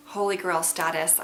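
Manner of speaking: ordinary delivery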